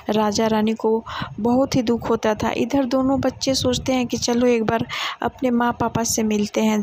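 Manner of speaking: plain reading voice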